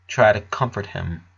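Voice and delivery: ordinary delivery, nothing marked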